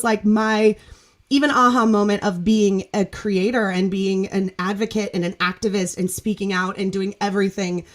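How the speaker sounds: normal talking voice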